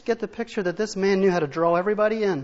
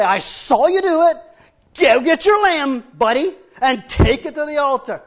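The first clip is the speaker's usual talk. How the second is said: high, loud nagging call; mock scolding neighbour; 6 semitones higher, 9 dB louder than usual. Neighbour telling a sinner to take his lamb, mock scolding shout. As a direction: shame him into it, hammed-up.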